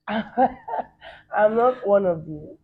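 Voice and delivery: laughing through the words, very slow; giggling answer to a teasing question. Real, not acted. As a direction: let the laugh drag the words out.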